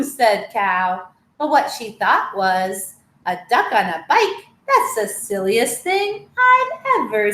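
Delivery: lively storybook read-aloud with voiced animals; reading to children. Teacher reading a picture book to children, lively. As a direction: tell the tale brightly to little listeners.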